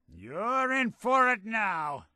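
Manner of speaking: high, drawn-out threat called out; combat taunt. Acted. Fighter to enemy in combat, called-out threat.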